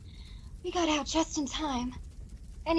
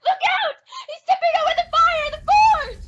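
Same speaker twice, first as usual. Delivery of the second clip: shrill alarm, much higher and louder; terrified cry to the hero; panicked warning scream; 12 semitones higher, 8 dB louder than usual. Acted. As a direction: scream a warning as the forge tips. Woman warns of the fire.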